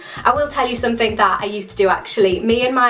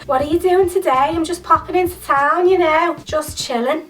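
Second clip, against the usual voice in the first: high, playful Scouse impression; light mock Scouse voice; Scouse accent; 5 semitones higher than usual. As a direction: put on a breezy Liverpool accent. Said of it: "I just love this accent".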